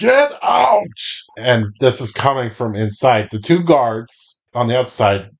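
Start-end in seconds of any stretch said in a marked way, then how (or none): 0.0-0.9 high, forceful yell; shouted order. Acted. Game master voices someone yelling from inside, high shout. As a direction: bellow it so it carries outside. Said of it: "all of you can hear this".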